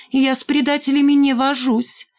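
high, airy, dismissive voice; coy snub. A narrator's reading. Шмакова snubs Димка. (высоким надменным голоском)

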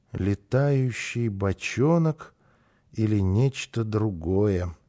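slow, low, soft murmur; hushed, wondering storybook voice; muttered musing. A narrator's reading. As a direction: mumble it slowly to yourself. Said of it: "пробормотал малыш".

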